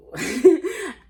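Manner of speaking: short loud laugh; burst of laughter. Real, not acted. Reacts to wine excuse.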